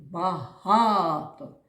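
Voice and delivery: "Багато" with the middle га loud and long; slow "Багато", leaning hard on га; stress on second syllable. Shown as a demonstration. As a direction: stretch and punch ГА in "Багато". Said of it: "а який склад наголошений?".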